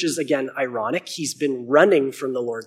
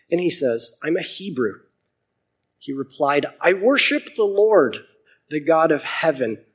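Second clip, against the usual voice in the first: steady Bible reading voice; clear, measured scripture reading; 3 semitones higher, 7 dB louder than usual. A narrator's reading. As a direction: read the passage out to the congregation. Reads Book of Jonah aloud.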